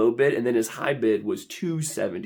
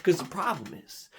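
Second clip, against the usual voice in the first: usual speaking voice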